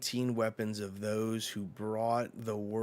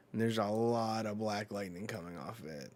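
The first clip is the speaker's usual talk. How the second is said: ordinary talk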